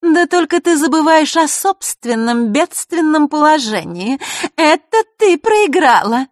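high, loud, slow sneer; scornful gloating. A narrator's reading. Marishka reminds Vasilisa of her plight, loudly scornful. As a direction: rub the defeat in, drawing it out.

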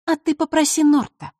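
put-on high-pitched snide girl's jab; malicious mock suggestion. A narrator's reading. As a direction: needle him in a high, sly voice. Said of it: "ехидно отозвалась Василиса".

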